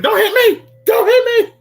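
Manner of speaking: high-pitched, loud mock scream. Imagining co-host about to be hit, mock screaming. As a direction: scream it in pretend panic. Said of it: "you just screaming".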